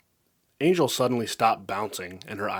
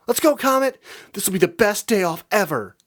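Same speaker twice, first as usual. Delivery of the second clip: very high, loud thrilled cheer; shouting with glee; excited shout; 10 semitones higher, 7 dB louder than usual. A narrator's reading. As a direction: yank him along with joy. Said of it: "she shouted".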